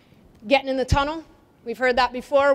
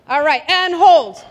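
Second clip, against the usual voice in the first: loud, slow, drawn-out call to freeze; calling hold; 3 semitones higher, 7 dB louder than usual. Real, not acted. Coach stops the players' drill, calling out loud and slow. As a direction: stretch out the hold so everyone stops.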